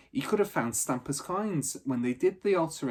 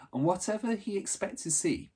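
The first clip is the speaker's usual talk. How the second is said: plain reading voice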